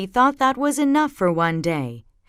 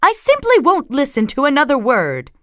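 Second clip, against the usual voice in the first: firm, raised motherly voice; higher, louder, put-upon mom; scolding mother; 7 semitones higher, 6 dB louder than usual. A narrator's reading. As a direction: lay down the law to whining kids. Mama scolds the whining cubs.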